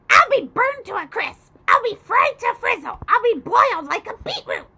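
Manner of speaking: high, loud wailing crone; panicking old hag. A narrator's reading. Grandma on fire wails to the boy, high and loud. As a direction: wail it like a frantic old witch.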